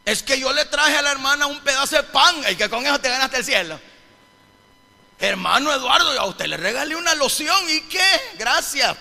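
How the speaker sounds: high, loud pulpit challenge; emphatic preacher voicing excuses; fervent preaching. Real, not acted. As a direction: throw the excuses out to the congregation.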